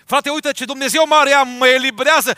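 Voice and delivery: loud, high joyful exclaiming; thrilled believer shouting praise; excited praise. Pretend. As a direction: burst out praising God's wonders. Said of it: "N-ai fi spus așa?".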